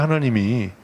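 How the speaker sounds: ordinary talk, nothing marked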